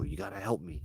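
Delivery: hushed desperate ask; pleading with Uncle Joe, softly; quiet plea. Retold or imitated. Begging uncle over walkie-talkie.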